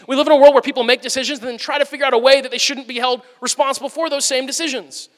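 ordinary talk, nothing marked

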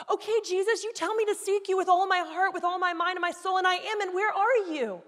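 loud, high-pitched angry plea to Jesus; desperate shouting. Retold or imitated. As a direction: shout accusingly, at the end of your rope. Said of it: "I'm saying it like this because that was me".

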